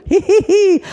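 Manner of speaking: high, loud giggles; giggling girl. Acted. Little girl giggling at her sparkly face. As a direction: giggle like a delighted child.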